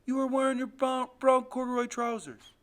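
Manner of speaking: put-on high, loud, urgent child's recital; urgent outpouring. A narrator's reading. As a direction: blurt the memory out to prove it. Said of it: "talking so fast it makes me shake".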